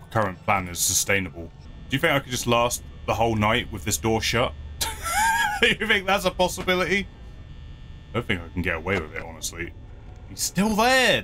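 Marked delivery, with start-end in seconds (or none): 4.6-5.6 high laugh. Real, not acted. Laugh at own idea. 10.3-11.2 exasperated cry. Real, not acted. Exasperated at animatronic.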